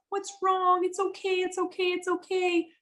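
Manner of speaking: high sing-song soothing voice. Retold or imitated. Adult cooing it's okay to a screaming child, high soothing. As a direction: coo it like calming a crying kid. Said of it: "Or you see, like".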